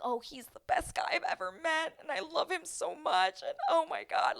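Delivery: high-pitched, breathless-fan imitation; mock gushing. Woman gushing about her guy, high mock adoration. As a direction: pitch it way up in lovestruck parody.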